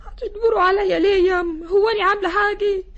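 sobbing and shouting at her mother; crying protest. Acted. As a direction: cry out the refusal through tears. Bride refuses forced marriage. (صراخ باكٍ)

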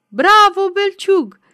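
high, loud, rousing cheer; spirited shout. A narrator's reading. Titu to the priest in the procession, spirited shout. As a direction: cheer him on at full voice. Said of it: "Titu strigă însuflețit".

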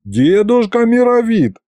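high, drawn-out entreaty; pleading. A narrator's reading. Pupil begs the sorcerer to drop the exam, pleading. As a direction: plead with the old man to relent. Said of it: "взмолился я".

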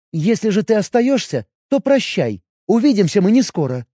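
jealous elf questioning her man; elf woman voice. Acted. Elf general giving orders.

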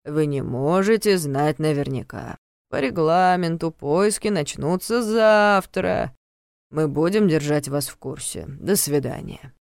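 slow, detached official voice; weary guard. Acted. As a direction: recite the rules without caring. Guard character voice held.

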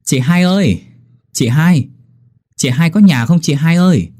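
high-pitched call to a neighbour. A narrator's reading. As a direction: call into the house for someone. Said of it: "thì kêu lên". (gọi với vào nhà, giọng cao)

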